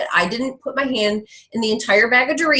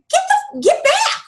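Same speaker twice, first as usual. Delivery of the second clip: much higher, squealing disbelief; incredulous squeal; 3 dB louder, slower than usual. Real, not acted. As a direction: shriek it like you can't believe it. Scoffs at the excuse.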